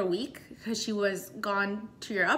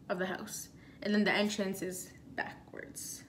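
breathy secretive whisper; 4 semitones lower, 11 dB quieter than usual. Real, not acted. Describing the basement room, she whispers it. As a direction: drop to a whisper, sharing a secret. Explaining hidden basement layout.

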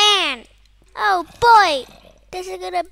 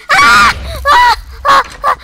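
short high shrieks of fright; panicked screams; 12 semitones higher, 15 dB louder than usual. Real, not acted. Screams during mob attack.

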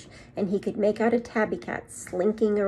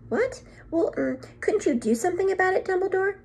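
much higher, startled protesting teacher; flustered McGonagall; 12 semitones higher than usual. A narrator's reading. McGonagall pleads with Dumbledore, high and flustered. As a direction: voice her shock, pitched way up.

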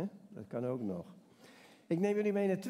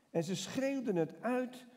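usual speaking voice

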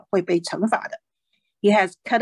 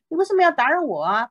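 higher, fast, put-upon complaint; annoyed Samuel; 7 semitones higher, faster than usual. Acted. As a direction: act out the disturbed prophet's grumble.

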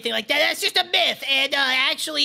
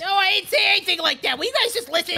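cranky; 6 semitones higher than usual. Acted. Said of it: "this guy's so cranky".

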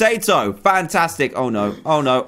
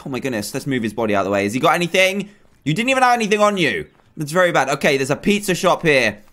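ordinary delivery

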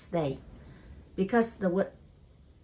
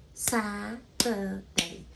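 "Saturday" very slowly as SA, TUR, DAY; syllable by syllable; 3 dB quieter, much slower than usual. Shown as a demonstration. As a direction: stretch and separate all three syllables of "Saturday". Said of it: "three syllable".

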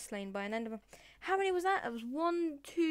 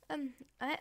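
usual speaking voice

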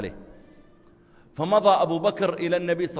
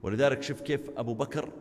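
ordinary talk, nothing marked

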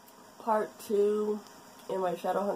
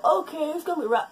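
excited; 10 semitones higher, 4 dB louder, faster than usual. Retold or imitated. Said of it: "I go".

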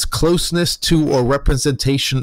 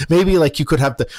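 normal talking voice

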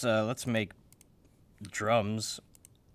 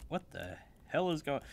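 ordinary talk, nothing marked

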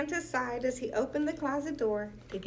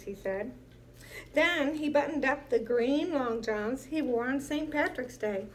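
usual speaking voice

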